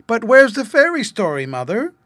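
put-on high, loud, eager child's question. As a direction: burst out impatient for the story. Child eagerly asks mother.

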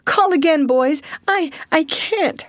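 high, urgent plea that falters at the end; overwrought, halting plea; faltering. A narrator's reading. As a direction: plead high, then stumble on the last words. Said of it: "faltered Alice".

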